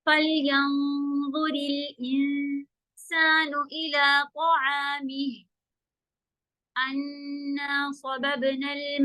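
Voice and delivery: very slow, drawn-out chant; slow Quran recitation. Shown as a demonstration. Teacher reciting for students, slow chant. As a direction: chant it slowly, pausing on the hamza.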